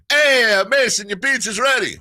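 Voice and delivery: high, loud playful Italian chef call; mock Italian accent. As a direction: call the kid like a hammed-up Italian pizzaiolo. Dad announces pizza, Italian chef bit.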